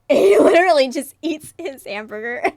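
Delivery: cracking up at the hamburger panel; laughing. Real, not acted. Reader laughing at the character eating his hamburger, loud laughter.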